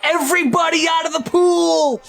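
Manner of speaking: acted lifeguard yell; calling out. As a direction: clear the pool with a long high shout. Lifeguard clears the pool.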